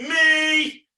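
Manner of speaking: high, loud rallying "Me!"; big, high, drawn-out shout; calling out. Pretend. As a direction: belt it out for a crowd of children. Calling children to shout along.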